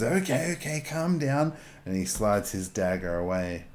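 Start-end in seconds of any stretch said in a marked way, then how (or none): 0.0-1.5 higher, backing-off calm down; placating Bart. Acted. Bart calms the aggressive hare, higher and placating. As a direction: soothe the angry player, pitched up. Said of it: "taken aback at your aggressiveness and says".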